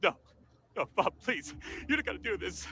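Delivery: normal talking voice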